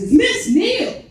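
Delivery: very high, slow indignant correction; all-caps emphasis. Acted. Comic emailing back about the pronoun, drawn-out high all-caps retort. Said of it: "email back in all caps".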